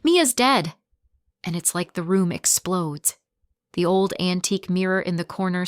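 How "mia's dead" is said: high, loud screamed reveal. A narrator's reading. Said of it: "Mom screams".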